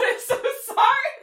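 laughing apology, high and loud. Real, not acted.

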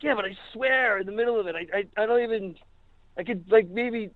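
high, stammering mock protest; retold pleading. Begs for another take, retold.

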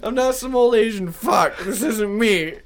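over-the-top panicked man; hammed-up desperate cadaver. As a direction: overplay the despair. Hammed-up second take.

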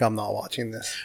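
low, soft refusal; dismissive aside. Retold or imitated. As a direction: drop the voice, uninterested. Own dismissive reaction to a reel.

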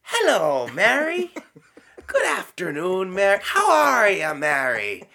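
high, loud chatty hello; casual mock greeting. Nun mocking a casual angel greeting, high and loud. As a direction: greet Mary like a neighbour, in the nun's voice. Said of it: "And he didn't say".

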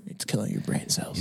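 unvoiced, hushed doom warning; hushed conspiracy whisper about phone radiation; ominous whisper. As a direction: whisper it like a dark secret.

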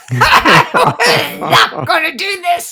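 loud, high and drawn out through laughter; laughing cry of someone bailing out; hammed-up, high-pitched refusal; mock panicked outburst. Imitating son fleeing in panic.

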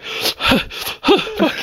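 high whispered sob of a curse; mock crying curse. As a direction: choke the word out like you're crying. Imitating Matt crying.

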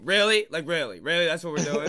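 loud, disbelieving challenge; exasperated disbelief; incredulous reproach. Retold or imitated. Acted reproach to elevator rider.

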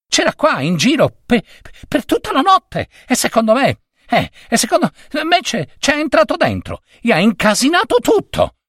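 put-on high, hurried boy's voice; anxious boy. A narrator's reading. Errand boy blaming the Gazette to his boss, high and hurried. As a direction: rush the excuse out, high. Boy blames the rival paper.

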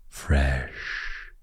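"Fresh" very soft, sh breathed long; savoring fresh. Shown as a demonstration. Savouring the word again for listeners, very soft. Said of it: "such a good word".

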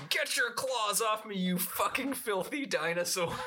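high-pitched imitated shout. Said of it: "he's just shouting".

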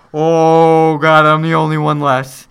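loud, hammed-up anguish; mock despairing Iron Man. Iron Man alone on the planet, loud hammed-up despair. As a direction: groan it out like the last man standing. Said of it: "Iron Man's just like".

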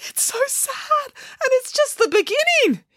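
very high-pitched, drawn-out lament; wailing. A narrator's reading. Boris upset by the sad story's beginning, wailing. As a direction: wail as if overcome, high and strained. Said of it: "wailed Boris".

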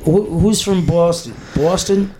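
experimenting with a Boston accent; unsure stab at Boston speech. Shown as a demonstration. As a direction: test out the Boston vowels, questioning. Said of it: "Is that how they say it?".

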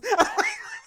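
a squealing laugh up high; high delighted laugh after joking about Welsh vowels; high burst of laughter. Real, not acted. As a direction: break into a high giggle at your own joke. Laughing at her own joke.

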